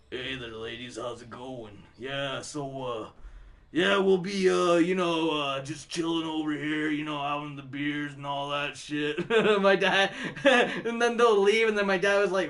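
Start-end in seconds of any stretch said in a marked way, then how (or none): 0.1-9.3 much lower, much softer suave rocker voice; deep smooth-guy voice. Retold or imitated. Answering the girls at the bar, a deep soft suave voice. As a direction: drop low and play it cool for the ladies. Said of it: "What the fuck was that?".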